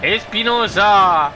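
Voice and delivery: excited football commentator voice; live play-by-play. Acted. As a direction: call the match as it happens. Live commentary of his match.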